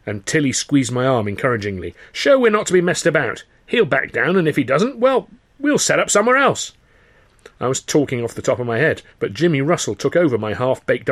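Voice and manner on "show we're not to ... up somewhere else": insistent. Acted. Heated insistence.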